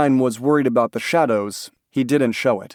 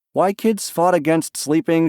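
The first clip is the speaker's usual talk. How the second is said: ordinary delivery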